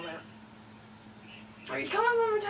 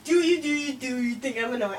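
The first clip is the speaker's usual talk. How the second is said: usual speaking voice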